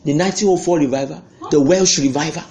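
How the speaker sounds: ordinary talk, nothing marked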